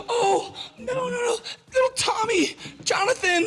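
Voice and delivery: hammed-up high-pitched parental panic; panicking parent. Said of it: "the more you start panicking".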